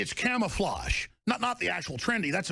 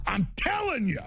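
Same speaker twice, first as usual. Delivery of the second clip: emphatic repeat; 6 semitones higher than usual. Real, not acted. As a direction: hammer the point home.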